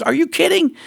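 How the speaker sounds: high incredulous outburst; high, disbelieving cry. Retold or imitated. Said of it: "He goes".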